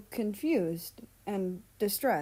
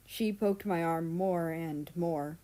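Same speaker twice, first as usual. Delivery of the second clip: ordinary delivery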